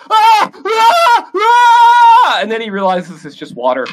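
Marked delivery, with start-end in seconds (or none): 0.1-2.4 high loud panicked screaming; panicked screams. Acted. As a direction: scream as the hose hits him. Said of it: "He's like".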